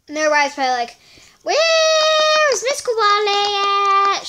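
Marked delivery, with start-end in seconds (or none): 1.4-4.3 loud, high, drawn-out call; mock fan wail. Imitating fans asking after a missing player, exaggerated wail. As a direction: stretch it out like a wailing fan.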